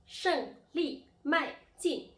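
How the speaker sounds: 胜利迈进 loud and high, each syllable falling sharply; emphatic falling-tone drill on 胜利迈进; fourth-tone demo. Shown as a demonstration. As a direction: punch out 胜利迈进 slowly, one falling syllable at a time. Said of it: "now the fourth tone".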